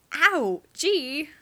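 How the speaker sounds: startled ow at the towel snap; yelp of pain. Acted. James yelping as the towel hits him, loud yelp. Towel whipped at his backside.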